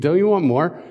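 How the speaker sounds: a listener craving the story's next chapter; eager question. Retold or imitated. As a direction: ask it like a hooked listener. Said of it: "You think".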